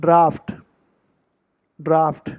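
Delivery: draft with long AH and F; correct British pronunciation. Shown as a demonstration. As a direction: say draft as 'drahft', standing alone. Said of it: "The correct pronunciation of this British word is". (draft को 'ड्राफ्ट' बोलें)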